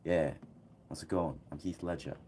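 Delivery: soft, quiet Australian accent, a touch botched; a gentle, subtle Australian accent said low; lightly put-on Australian accent, almost under the breath; Heath Ledger accent. Shown as a demonstration. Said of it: "subtle Australian accent".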